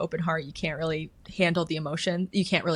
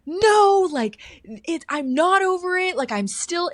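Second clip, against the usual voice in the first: high, loud angry protest; frustrated outburst at God; pissed off; 12 semitones higher, 8 dB louder, slower than usual. Retold or imitated. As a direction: snap back at God in frustration. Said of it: "I got so pissed off at God".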